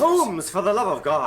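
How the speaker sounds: high, frightened plea; alarmed plea. Acted. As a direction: beg him to stop, voice climbing. Pleading as Holmes threatens.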